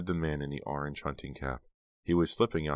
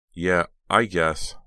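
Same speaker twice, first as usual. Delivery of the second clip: reluctant; 3 semitones higher, 8 dB louder, slower than usual. Acted. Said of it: "Dina said reluctantly".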